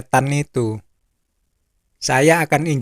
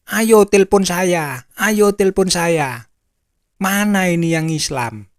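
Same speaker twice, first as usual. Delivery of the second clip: higher-pitched, goading call-out; mock challenge; 5 semitones higher, 3 dB louder than usual. Live-streamer picks a fight with Muslims, mock goading. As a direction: dare them to phone in.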